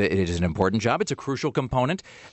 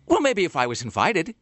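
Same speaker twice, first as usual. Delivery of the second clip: imitating a sugary sweet reply, pitched up; mock sweet-as-pie; 7 semitones higher than usual. Host imitating guest's pointed phone reply, mock sweet as pie. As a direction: sweetly needle the host, voice raised. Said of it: "this voice that can only be described as just being sweet as pie".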